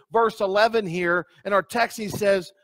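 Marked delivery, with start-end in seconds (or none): none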